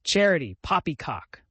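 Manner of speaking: loud scornful snort on Charity; a hint of a gruff uncle, snorting. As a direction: scoff at the very word. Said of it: "snorted Uncle Benjamin".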